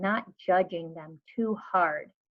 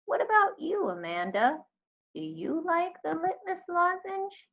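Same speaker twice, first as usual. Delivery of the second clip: put-on lightly raised, gentle old lady voice; a hint of a kindly old librarian asking; mild high old-lady voice; 10 semitones higher than usual. A narrator's reading. Miss Franny voiced asking Amanda.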